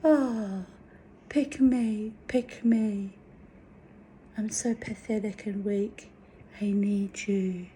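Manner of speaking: high, small, needy mock voice; pathetic pleading. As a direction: whimper it like a weak begging self. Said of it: "I'll be all".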